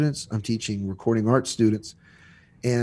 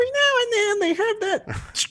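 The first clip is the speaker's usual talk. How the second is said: pitched way up, imitating a piercing voice; piercing voice demo. Shown as a demonstration. Podcast guest showing a problem voice that needs turning down, piercing high. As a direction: jab it high like a dart to the brain. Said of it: "they have that brain dart or something".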